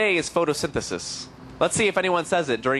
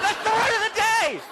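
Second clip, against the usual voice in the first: excited shout. Acted. Repeated exclamation.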